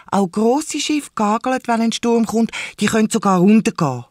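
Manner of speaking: put-on high, insistent child voice; protesting little girl. A narrator's reading. Lisa arguing with her uncle about storms, high and insistent. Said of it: "protestiert sie".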